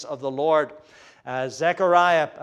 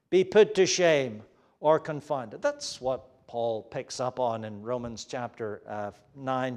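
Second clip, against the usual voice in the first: ordinary talk, nothing marked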